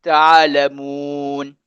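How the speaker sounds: drawn-out "Ta'lamun", slow and clear; "Ta'lamun" recited slowly and evenly; slow repeat. Shown as a demonstration. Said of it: "Cuba sebut sekali lagi".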